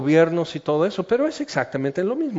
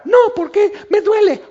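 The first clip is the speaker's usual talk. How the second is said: much higher, louder wounded whine; high squeaky offended protest; whiny hurt protest; 5 dB louder than usual. Retold or imitated. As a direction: complain like a hurt child. Person offended when yelled at.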